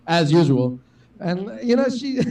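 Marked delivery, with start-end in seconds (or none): 0.1-0.8 lower, louder mock voiceover aside; nature documentary narrator. Comic tags on the documentary narrator's remark, mock narrator voice. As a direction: drop into a lightly mimicked narrator voice. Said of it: "the guy who's, like, narrating the whole thing".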